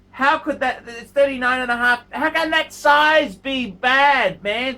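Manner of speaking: loud, slowing disbelief; can't believe anyone would complain; exasperated outburst. Real, not acted. Exasperated rant at viewer's watch.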